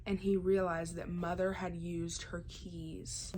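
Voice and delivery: ordinary talk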